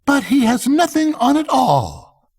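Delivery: put-on very high child voice; a child blurting out the truth; little child. A narrator's reading. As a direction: speak as a small innocent child. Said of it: "said a little child".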